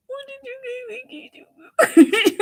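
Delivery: high squealing question, the second one loud, drawn-out and laughing; excited squeal. Real, not acted. Begging chat for the gossip, high laughing squeal. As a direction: squeal it, dying to know.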